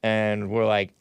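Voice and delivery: usual speaking voice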